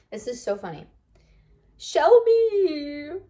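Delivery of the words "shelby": high, louder whiny call of the name; crying emoji face. Pretend. Reading a fan's text aloud, whining the name. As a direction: play the sobbing emoji as a voice. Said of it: "I'm doing the emoji face".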